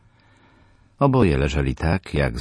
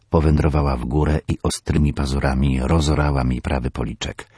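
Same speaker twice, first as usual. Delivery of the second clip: ordinary delivery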